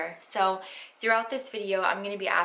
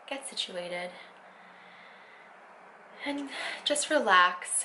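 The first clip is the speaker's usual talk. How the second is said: guided meditation; 4 dB quieter than usual. Acted. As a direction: ease them into stillness, unhurried.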